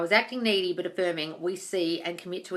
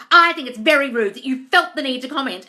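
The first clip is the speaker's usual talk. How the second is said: raised, much louder prissy mimicry; mocking prissy voice; 5 semitones higher, 10 dB louder than usual. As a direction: sneer as you quote her indignant comment. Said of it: "she was like".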